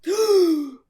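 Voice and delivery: big, loud shocked gasp; theatrical sharp intake of breath; dramatic gasp. Acted. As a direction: gasp loudly as if stunned. Dramatic reaction within told story.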